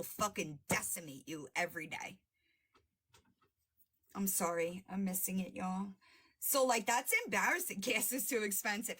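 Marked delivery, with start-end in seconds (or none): none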